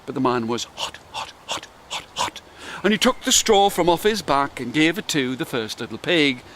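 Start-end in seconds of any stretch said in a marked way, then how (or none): 0.8-2.4 breathy whispered repetition; whispered hot. A narrator's reading. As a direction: whisper the heat out. Describing the heat.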